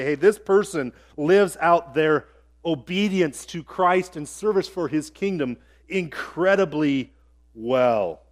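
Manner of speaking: ordinary talk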